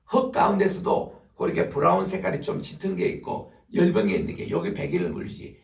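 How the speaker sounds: usual speaking voice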